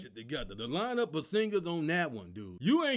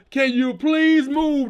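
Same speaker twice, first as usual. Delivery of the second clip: mock PA yell; 5 semitones higher, 12 dB louder, slower than usual. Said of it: "They yelled through the P A system".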